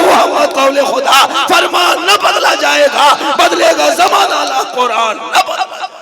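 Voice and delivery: loud, drawn-out rallying verse; fiery declamation. Acted. Preacher reciting a couplet to the gathering, fiery declamation. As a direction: declaim it slowly to the crowd.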